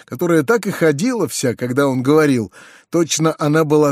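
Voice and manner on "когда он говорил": ordinary delivery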